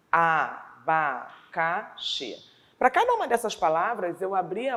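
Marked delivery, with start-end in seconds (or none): 0.1-1.2 abacaxi devagar, a-ba-ca-xi; separado em sílabas. Shown as a demonstration. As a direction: conte as sílabas de abacaxi na voz. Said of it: "eu precisei abrir a boca quatro vezes". (abacaxi pausado, sílaba por sílaba)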